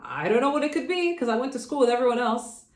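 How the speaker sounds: a defensive, confused "well"; retelling how she answers people who say her voice sounds different; baffled reply. Retold or imitated. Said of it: "And I'm like".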